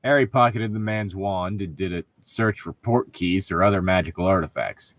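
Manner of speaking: ordinary talk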